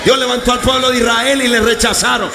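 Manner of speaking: high, driving rebuke to the congregation; preaching at full force. Real, not acted. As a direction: scold the church in a rapid preacher's shout. Reprende a la congregación predicando. (predicación encendida, reprendiendo a la iglesia)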